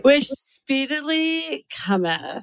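put-on very slow, drawn-out Valley girl; Valley girl accent. Shown as a demonstration. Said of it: "Southern California Valley girl accent with".

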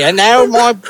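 retry the put-on Australian accent; attempted Australian accent. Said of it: "in an Australian accent".

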